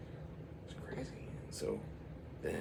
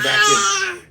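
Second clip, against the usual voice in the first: high, loud startled narration; sudden rush; 14 dB louder than usual. A narrator's reading. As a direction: jolt of surprise.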